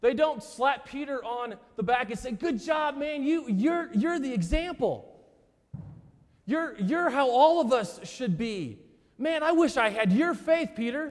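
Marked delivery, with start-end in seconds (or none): 2.3-11.0 much higher, gushing buddy praise; hammed-up fanboy awe; mock admiration. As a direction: disciples back-slapping Peter, mock gushing admiration. Disciples imagined praising Peter.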